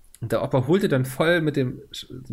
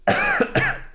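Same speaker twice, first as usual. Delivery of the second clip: coughing on a drink; 5 dB louder than usual. Real, not acted. Coughs after taking a drink.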